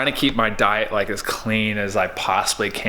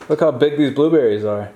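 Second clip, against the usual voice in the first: amazed and louder; excited, louder; 4 semitones higher, 5 dB louder than usual. Real, not acted. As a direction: show them off with delight. Showing off blueberries.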